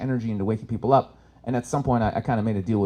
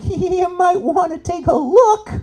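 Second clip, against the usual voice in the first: much higher, slow, teasing suggestion; knowing hint; 5 dB louder, much slower than usual. Retold or imitated. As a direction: drag it out with a sly nudge. Hinting to yoga guy.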